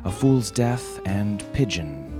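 ordinary delivery

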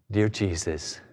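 low, soft, listless praying; hammed-up sedate bedtime prayer; mock prayer. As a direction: pray without any desperation. Mimics a complacent, sleepy prayer.

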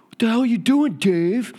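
loud, high, outraged yell at the surfer; mock angry yell. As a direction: bark it out like an angry local surfer. Surfer yelling at him in water.

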